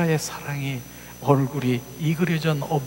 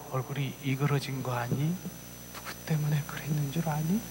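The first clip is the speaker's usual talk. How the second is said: hushed telling; 9 dB quieter than usual. Retold or imitated. Uncle confronts child about mother.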